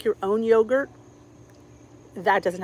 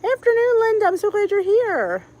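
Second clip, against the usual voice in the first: high-pitched, louder welcome; excited greeting; 11 semitones higher, 5 dB louder than usual. Real, not acted. Welcoming Linda to the livestream, excited. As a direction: ring out a high, delighted hello.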